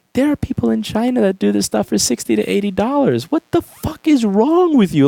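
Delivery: high-pitched incredulous scolding, slowing at the end; mock outraged disbelief. Acquaintances questioning his costly hobby, mock incredulous outrage. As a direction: imitate someone appalled by a friend's folly. Imitating people appalled at his spending.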